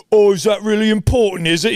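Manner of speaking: high, loud, snide mocking voice; mocking sports fan. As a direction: needle him like a smug stranger in the stands. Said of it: "And he's like".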